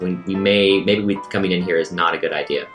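ordinary delivery

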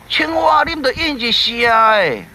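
high call to the frog, drawn out. Acted. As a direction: call into the dark for your friend. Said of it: "三角鱼讲".

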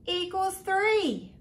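plain reading voice

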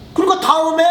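high, loud taunt; teasing bully. Acted. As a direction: toy with him. Bully mocking word games.

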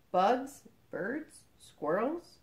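ordinary delivery

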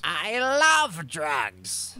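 very slow loud drawn-out declaration; drake. Acted. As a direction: stretch every word out loud. Drake-like bonding moment.

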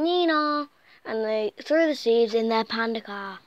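usual speaking voice

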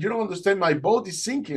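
high, urgent explanation; retold alarm. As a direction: break the emergency to the swimmer. Boatman announces emergency.

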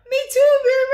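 cracking up as you agree; laughing. Real, not acted. Vlogger laughing along with Miriam's reaction.

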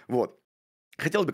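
usual speaking voice

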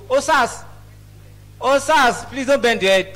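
loud call across the room. Real, not acted. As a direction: call him out from the crowd. Comic calling out to friend.

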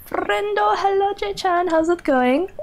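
high, bright sing-song hello; excited high hello to a chat friend; squeaky greeting. Real, not acted.

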